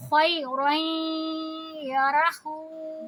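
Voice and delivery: slow chant, رائیں nasalised two counts; Quran recitation with ghunna. Shown as a demonstration. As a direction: hum the nasal in رائیں before یا. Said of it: "غنہ کی آواز کرتے ہیں اور دو حرکہ". (رائیں میں غنہ کے ساتھ آہستہ تلاوت)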